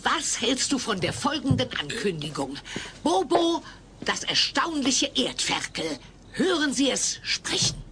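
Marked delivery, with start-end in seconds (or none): 3.0-7.8 a hint of a barker's call; announcer. Showwoman trying a mock barker's call on Alf. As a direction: lightly sell the freak show act.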